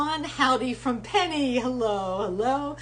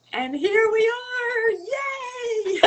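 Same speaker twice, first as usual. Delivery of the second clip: excited cheer; 13 semitones higher, much slower than usual. Real, not acted. Host cheering as her guest's video finally connects, delighted.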